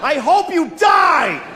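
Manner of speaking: high, slow shout; road rage shout. Retold or imitated. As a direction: scream it slowly and high at another driver. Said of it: "I said that to a person".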